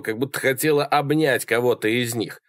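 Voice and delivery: ordinary delivery, nothing marked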